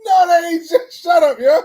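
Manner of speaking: high, loud laughing yell; laughing shout. Real, not acted. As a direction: yell it while cracking up. Laughing outburst at co-host.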